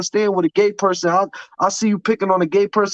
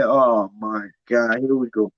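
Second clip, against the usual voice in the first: exasperated groan; 6 semitones lower, slower than usual. Retold or imitated. Exasperated reaction at meeting call.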